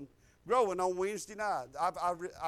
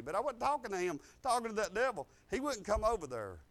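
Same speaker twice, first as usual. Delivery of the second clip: usual speaking voice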